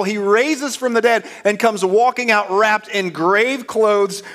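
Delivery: high, loud, excited preacher; full-force preaching. Real, not acted. Preacher has congregation imagine witnessing the miracle, full force. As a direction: build the wonder at full voice.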